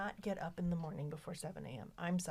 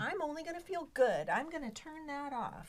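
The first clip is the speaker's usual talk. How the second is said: higher, slowing at the end; fake-cheerful shutting feelings off; mock denial voice; 8 semitones higher than usual. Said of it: "It's like".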